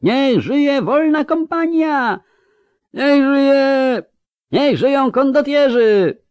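very high, loud drawn-out shouts; roaring crowd cheers. A narrator's reading. Crowd hails the free company of mercenaries, roaring cheers. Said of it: "Tłum ryczał, wiwatował".

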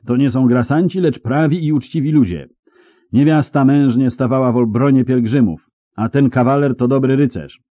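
loud, high chivalrous defence; loud vouching. Acted. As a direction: declare their innocence at full voice. Knight loudly vouching for captives.

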